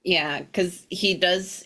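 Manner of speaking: ordinary delivery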